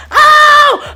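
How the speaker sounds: high shrieking oh; mock scream. The lady screaming on the floor, shrieking oh. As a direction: screech it like the lady. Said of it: "screaming to the top of her lungs".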